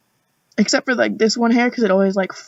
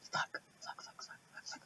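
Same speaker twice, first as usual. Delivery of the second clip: under-breath whispered swearing; frustrated whisper while searching; whispered cursing; 28 dB quieter than usual. Real, not acted. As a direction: mutter curses to yourself in a whisper.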